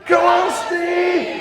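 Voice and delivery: high, loud, slow drawn-out chant; the whole stadium roaring for Steve; crowd shouting. Retold or imitated. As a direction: chant his name as one huge crowd. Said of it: "everybody's shouting".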